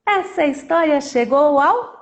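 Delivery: playful chanted sign-off with a drawn-out last word; sing-song story ending; storyteller's closing rhyme. Acted. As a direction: ring out the ending for children.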